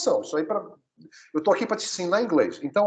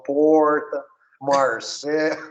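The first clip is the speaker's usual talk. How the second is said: put-on caipira accent with drawn-out retroflex R; interior São Paulo accent; much slower than usual. Teacher jokes about countryside São Paulo accent, caipira R. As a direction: lean on the curled rural São Paulo R. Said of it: "se você tivesse no interior de São Paulo".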